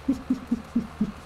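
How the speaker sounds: quick amused giggle; a brief high chuckle; short laugh. Real, not acted. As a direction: laugh it off lightly. Laughs at Ela walking.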